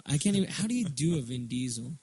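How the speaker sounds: normal talking voice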